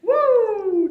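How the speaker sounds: high, loud "woo"; celebratory whoop. Pretend. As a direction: whoop loudly to kick things off. Cheer after opening the Q and A.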